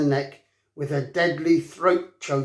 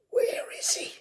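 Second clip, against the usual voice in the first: breathless whispered hiss; a dying dragon barely able to speak; weak hiss; 6 dB quieter than usual. A narrator's reading. Wounded dragon hisses weakly, searching for Hiccup. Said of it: "he hissed weakly".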